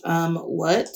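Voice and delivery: quiet, low, half-hearted question; low-key, uncommitted response; unenthusiastic. Real, not acted. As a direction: answer softly without much interest. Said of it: "you didn't say it like you mean it".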